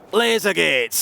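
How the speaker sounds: a badly faked accent on "Laser gates"; mock accent. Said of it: "Terrible accent".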